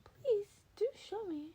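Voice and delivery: high, bright pleading with a crack; Nezu's squeaky eager request; eager high-voiced Nezu. Nezu eagerly asks for a demo.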